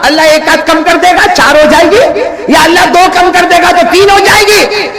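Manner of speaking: high, ringing proclamation; full-force preaching. Acted. Preacher declares the command of prayer to the faithful, high full-force preaching. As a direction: proclaim it to the whole congregation.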